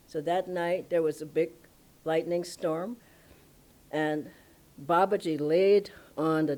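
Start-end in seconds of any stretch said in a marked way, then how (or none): none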